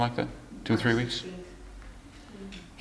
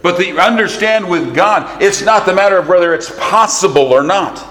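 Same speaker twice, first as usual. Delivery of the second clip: high, ringing, drawn-out pulpit emphasis; forceful preaching; 6 semitones higher, 4 dB louder than usual. Real, not acted. Preacher assuring folks of what God can do, forceful and high. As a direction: preach it with weight, stretching key words.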